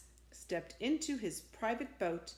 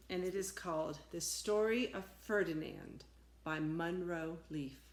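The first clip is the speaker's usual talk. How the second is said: usual speaking voice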